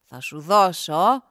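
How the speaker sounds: low, loud character voice; hearty thanks in a deep voice, slowing at the end; grateful gardener. A narrator's reading. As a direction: announce a reward, deep and full-voiced. Grateful gardener rewards the young man.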